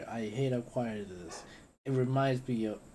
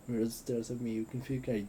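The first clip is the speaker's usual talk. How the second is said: usual speaking voice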